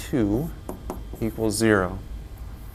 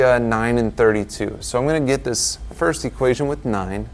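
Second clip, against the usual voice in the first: usual speaking voice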